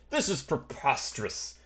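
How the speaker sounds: blustering, drawn-out indignation rising in pitch and volume; indignant outrage. Acted. Carriage passenger to attackers threatening him, indignant outrage. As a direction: build from slow disbelief to a loud demand.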